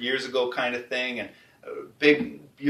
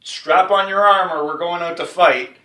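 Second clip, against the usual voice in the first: rousing call; 4 semitones higher, 10 dB louder than usual. Retold or imitated. God calling to battle.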